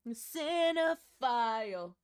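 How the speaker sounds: slow, loud, raspy singing; smoker's rasp. Real, not acted. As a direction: croak it out like a smoker. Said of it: "Why have I got smoker's voice today?".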